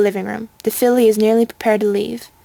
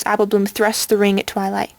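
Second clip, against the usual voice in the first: plain reading voice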